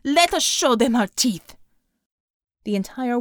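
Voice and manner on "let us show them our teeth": rallying shout. A narrator's reading. Commander rallying troops.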